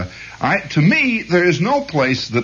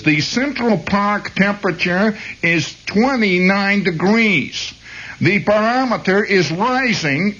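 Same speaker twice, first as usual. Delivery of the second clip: put-on higher, slow Brooklyn accent forecast; Brooklyn weather girl; 9 semitones higher, slower than usual. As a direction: read it like the Brooklyn phone weather lady. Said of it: "this lady the other night says".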